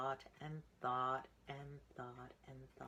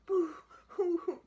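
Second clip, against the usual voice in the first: high, loud hammed-up boo-hoo; mock crying; 13 dB louder than usual. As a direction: wail it like a pretend-crying little pig. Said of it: "cried Piggy's brother".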